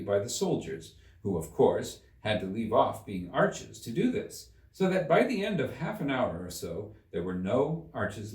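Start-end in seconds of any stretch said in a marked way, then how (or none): none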